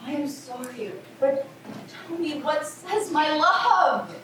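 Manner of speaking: exasperated pleading. Acted. Juliet begging the nurse for news, exasperated. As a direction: stretch out the last word impatiently.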